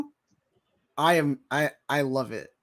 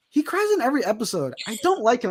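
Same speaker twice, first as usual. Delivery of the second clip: higher, quick griping in her place; girlfriend's complaint; 7 semitones higher, faster than usual. Retold or imitated. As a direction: voice a put-out viewer complaining. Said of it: "she was like".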